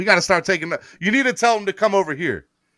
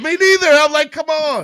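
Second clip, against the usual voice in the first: high, loud exasperated whine; mock exasperation; 10 semitones higher, 7 dB louder than usual. Teasing his cohost, high mock exasperation. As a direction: squeal it like you're fed up.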